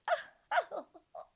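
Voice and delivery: high, loud gleeful laugh; delighted laugh. A narrator's reading. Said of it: "laughed with delight".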